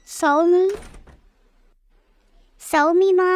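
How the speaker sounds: loud searching call. Acted. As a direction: call through the house for someone. Searching the house, calling someone's name.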